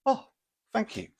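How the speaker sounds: put-on very high, delighted girlfriend voice; mock gushing thanks. Retelling the date's thanks as he pays, very high mock delight. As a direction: squeak the thanks like she's thrilled. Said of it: "she thanks him for paying".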